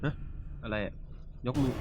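normal talking voice

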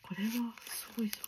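quick excited whisper; whispered thrill at the find; fast whisper. Real, not acted. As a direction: rush it out under your breath. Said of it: "あんまり大きい声で叫べれないのがね".

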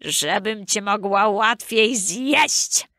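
very slow, drawn-out wolf voice; slow wolfish exclamation, without real shouting; exclaiming wolf. A narrator's reading. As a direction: stretch it out as the menacing wolf. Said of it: "wykrzyknął wilk".

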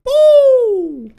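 long high squeal of excitement; shrill gamer squeal. Real, not acted. Reacting to a Pokémon appearing.

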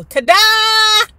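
loud high showy reveal. Retold or imitated. Father revealing the stolen skates, loud triumphant ta-da. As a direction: present it like a magic trick. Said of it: "Daddy open up his hands and go".

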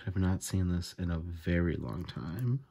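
ordinary talk

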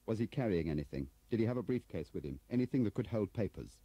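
urgent questioning. Acted. Urgent questioning about Harting's briefcase.

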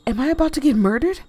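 fast, loud alarmed question; panicked. Acted. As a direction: blurt it in alarm. Panicked in story.

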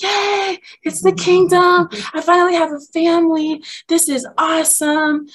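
high-pitched mock-innocent delight; gleeful celebration. New disciple celebrating finding a family, high gleeful cheer.

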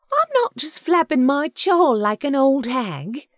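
high, loud, slow character protest; indignant Agatha. A narrator's reading. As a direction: insist on it slowly, high and loud. Agatha indignant at curt thanks.

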